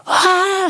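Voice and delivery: high imitated shout from afar; mock yell. Imitating a man yelling in the tunnel, high mock shout. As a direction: call out like a distant fan. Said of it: "Il y a un mec qui crie".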